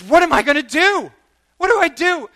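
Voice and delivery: high, loud, pleading cry of a broken man; desperate plea. Retold or imitated. Businessman weeping after the prophecy, high desperate plea. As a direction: beg for help, voice climbing.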